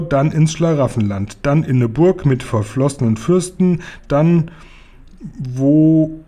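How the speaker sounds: halting schoolboy composition, with long pauses between words; schoolboy's essay. Acted. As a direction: read it out haltingly, like a boy's essay. Reads out the boy's school essay. (stockend vorgelesener Schulaufsatz eines Jungen)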